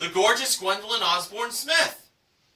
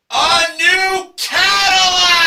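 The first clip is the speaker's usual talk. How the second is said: high, loud, stretched-out shout; excited prize reveal; 9 semitones higher, 9 dB louder, slower than usual. Acted. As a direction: announce the car like a jackpot. Announcing the big car prize.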